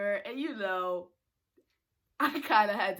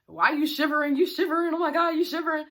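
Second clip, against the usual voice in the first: raised-pitch teasing chant; making fun; 5 semitones higher, 4 dB louder, faster than usual. Retold or imitated. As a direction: needle them with mock concern. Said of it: "He made fun of me".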